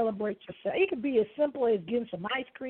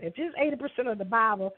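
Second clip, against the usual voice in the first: normal talking voice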